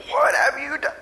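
high, loud mock outburst; mock harsh God. Shown as a demonstration. Pastor mocking a misreading of God, high indignant outburst. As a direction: shriek it like an irritated person. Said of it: "that's not God's personality".

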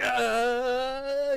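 loud high faked grunt of effort; fake strain grunt. Half-orc faking effort at arm wrestling, loud high mock grunt. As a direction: pretend to strain hard, hammed-up. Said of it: "pretends to strain".

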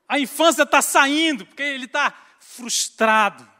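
loud, slow, high preacher's cry; full-force pulpit preaching; fiery preaching. Acted. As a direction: preach it out to the whole church.

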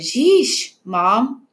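a high, loud exasperated yeesh; mildly exasperated father; exasperated sigh-word. A narrator's reading. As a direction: throw up your hands at her fussing. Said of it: "fully exasperated".

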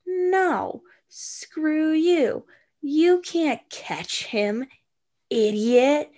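high, hissed "screw you", slow taunt; mock defiant. Wife refusing the Prince of Wales, high defiant sneer.

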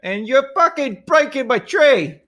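mock-scold the cat for wrecking the tree; telling off a cat mid-show, high and exasperated. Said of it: "It's like".

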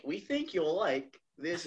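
high, soft mock-friendly app voice; cutesy recommendation voice. Twitter recommending a video to a user, mock sweet. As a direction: pitch it up softly, like a helpful suggestion.

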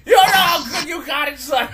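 high, fast, shouted parental rant; hammed-up yelling at an ungrateful kid. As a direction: cry out like an exasperated parent. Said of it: "I'm just all like".

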